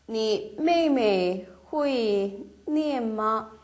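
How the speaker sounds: each syllable spelled out slowly; slow model reading. Shown as a demonstration. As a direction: read it slowly for learners. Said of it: "말을 쓰시면 돼요". (천천히 또박또박 읽는 예문)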